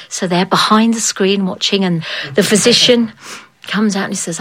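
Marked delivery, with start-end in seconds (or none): none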